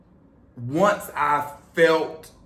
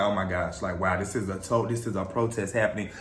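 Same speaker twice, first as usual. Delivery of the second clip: hushed realization; 10 semitones lower, 6 dB quieter, faster than usual. Retold or imitated. Retells a dawning realization in awe.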